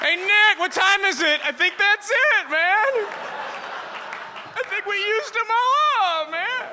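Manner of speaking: high, loud, lazy stoner drawl; stoner buddy voice. Retold or imitated. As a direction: play the baked roommate calling out. Stoner roommate voice.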